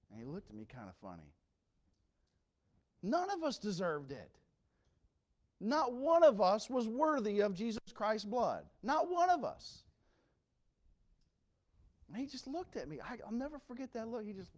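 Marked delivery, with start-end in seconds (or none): 3.0-9.8 loud, high, rising preacher cry; preaching at full force. Acted. Preacher crying out to the church, loud and high. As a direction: hammer the point home to the congregation.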